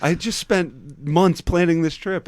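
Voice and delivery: loud, high, slow mock scolding; retold yelling. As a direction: imitate an exasperated leader yelling at a kid. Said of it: "would just yell at you".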